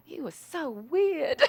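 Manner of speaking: high, drawn-out astonished verdict; slow, high-pitched retold marvelling; amazed. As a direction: stretch it out in mock amazement. Producer's astonished verdict acted out.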